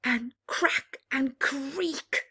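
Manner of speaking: lively read-aloud with bouncing rhyme; rhyming story narration. A narrator's reading. Reading a rhyming bedtime book to children, lively. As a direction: tell it to children, full of drama.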